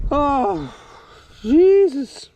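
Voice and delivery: high, loud groan of despair; strained, high Jesus of disbelief; anguished yell. Real, not acted. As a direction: cry out at another lost fish. Fish lost again.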